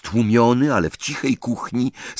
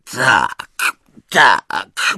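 a hint of a sulky elf muttering; grudging little elf, lightly voiced; mumbling house-elf; 2 semitones higher, 5 dB louder than usual. A narrator's reading. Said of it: "bąknął stworek".

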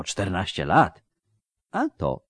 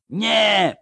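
high, terrified cry of refusal; panicked scream; 5 dB louder than usual. Acted. Wounded man screaming at the surgeon's knife. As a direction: scream it out in terror. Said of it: "rozdarł się ranny".

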